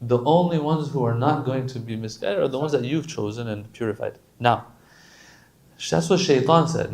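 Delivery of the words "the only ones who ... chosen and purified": normal talking voice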